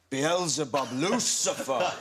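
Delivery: intoning the devil's names, a touch theatrical; lightly hammed incantation; mock devil summoning. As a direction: call up the devil dramatically. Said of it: "just as I was going".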